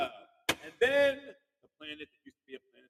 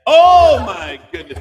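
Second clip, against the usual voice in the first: startled exclaim; 10 semitones higher, 14 dB louder, much slower than usual. Real, not acted. Host reacts to a cheeky answer.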